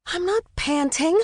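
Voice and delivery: high-pitched protest. A narrator's reading. Mother answering her teasing twins, protesting. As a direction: deny it indignantly, pitch up. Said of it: "she protested".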